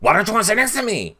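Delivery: mock Jason's overdramatic whining; high sassy impression of an upset houseguest; imitating Jason. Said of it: "Jason was like".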